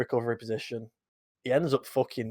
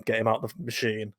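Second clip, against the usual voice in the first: ordinary talk, nothing marked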